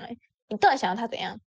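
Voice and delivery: a touch of a harsh snapped question, fast; imitating her scolding tone; mock fierce demand. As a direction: imitate the friend's sharp, quick demand. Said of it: "他很凶的跟我讲".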